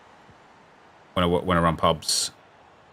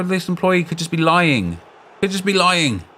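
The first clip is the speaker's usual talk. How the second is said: emphatic insistence; 10 semitones higher, 3 dB louder than usual. Real, not acted. Arguing the employee may lie.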